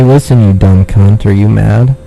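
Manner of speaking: ordinary talk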